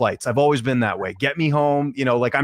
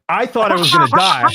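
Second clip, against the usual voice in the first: excited outburst; 11 semitones higher, 6 dB louder than usual. Real, not acted. Guest telling hosts about a wild bus ride, excited outburst. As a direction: pitch jumps high, louder, half laughing in disbelief. Bus driver racing to airport.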